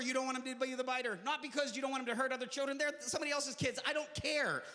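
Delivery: normal talking voice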